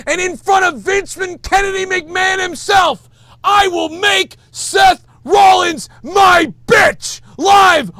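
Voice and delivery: high, loud wrestling-promo yelling; full-throated ranting challenge; furious shout. Real, not acted. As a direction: scream the challenge like a heel promo. Rant peaks into wrestling promo.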